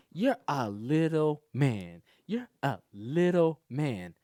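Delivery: slow, drawn-out teasing; deliberate, mocking slow delivery; pointed mimicry. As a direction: imitate her spelling it out slowly. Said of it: "the way she's doing it".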